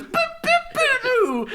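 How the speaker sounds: squeaky high boops, sung out; high boop noises. Retold or imitated. Stranger dancing with the soda, high squeaky boops. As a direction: bop out little high dance noises. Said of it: "He goes".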